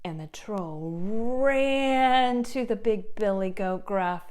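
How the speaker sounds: ordinary delivery, nothing marked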